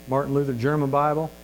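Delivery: ordinary delivery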